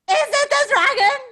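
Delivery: high loud fast panicked cry; alarmed shout. Acted. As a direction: blurt it out in alarm. Animals fear the dragon.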